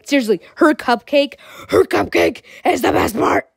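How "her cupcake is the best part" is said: rough, raspy monster-like voice; throaty mock-monster growl; growly silly voice. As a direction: growl it out with relish. Repeats in growly voice for fun.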